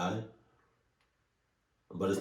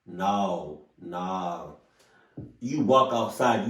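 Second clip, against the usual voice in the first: ordinary talk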